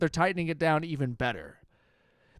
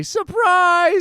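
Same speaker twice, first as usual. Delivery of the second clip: surprise shout; 13 semitones higher, 9 dB louder than usual. Retold or imitated. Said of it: "Nobody got to say".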